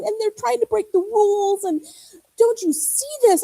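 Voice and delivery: retold frantic prayer, high and pleading; desperate plea. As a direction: beg God to get rid of them, half frantic.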